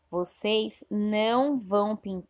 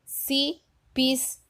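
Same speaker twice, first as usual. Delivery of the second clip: cipisna said slowly and higher, every syllable separate; teacher sounding out the jumbled cipisna; slow syllable reading; 6 semitones higher, much slower than usual. Shown as a demonstration. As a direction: read cipisna carefully, one syllable at a time. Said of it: "Eu vou ler do jeito que está no livro".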